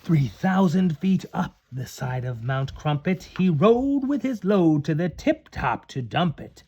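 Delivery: plain reading voice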